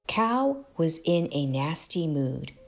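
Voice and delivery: ordinary delivery, nothing marked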